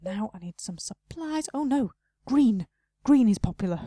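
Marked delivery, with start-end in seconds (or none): 1.5-3.8 loud, startled oh no; dismayed outburst. Real, not acted. Shop owner dismayed at a customer's order, loud. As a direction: blurt it out in alarm.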